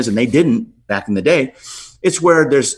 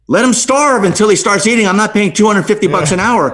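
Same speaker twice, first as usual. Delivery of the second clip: indignant outburst; 7 semitones higher, 4 dB louder than usual. Retold or imitated. Arguing with wife over costs.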